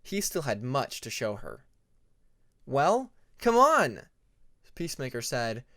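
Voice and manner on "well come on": high, loud and drawn out, eager to get going; an excited young dragon urging his friend on. A narrator's reading. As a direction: call her along with bright eagerness. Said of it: "urging Moon to follow him".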